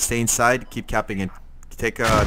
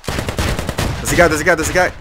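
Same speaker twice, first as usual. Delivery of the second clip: much higher, loud urgent spotting shout; alarmed callout; 7 semitones higher, 8 dB louder than usual. Real, not acted. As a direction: shout out the enemy you just saw.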